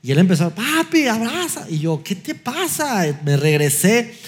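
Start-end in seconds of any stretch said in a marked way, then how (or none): 0.5-1.7 high-pitched mock pleading of an upset child; tearful child imitation. Son calls after dad walking away, imitated tearful pleading. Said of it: "empezó a llorar". 2.0-3.0 high, drawn-out exasperated question; exasperated dad. Retold or imitated. Dad to his clinging son, retold exasperation.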